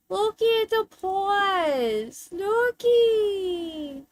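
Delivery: put-on high, slow baby talk to the cat; high drawn-out cutesy voice; cooing pet talk. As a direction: gush over the pet like a baby.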